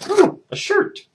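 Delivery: very high little ooh; pleased surprise. Real, not acted. Vlogger pulling out a shirt, pleasantly surprised. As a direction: coo at the find.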